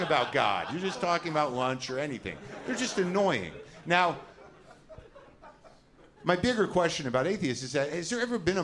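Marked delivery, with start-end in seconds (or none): none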